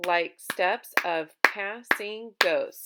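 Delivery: "like steps of passing ghosts" in six slow, loud beats; one beat per syllable. Shown as a demonstration. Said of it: "the next line has six syllables".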